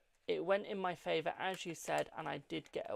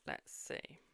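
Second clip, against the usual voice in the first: musing whisper; 13 dB quieter, much slower than usual. Real, not acted. Reading cards, pondering.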